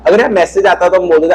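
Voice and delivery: usual speaking voice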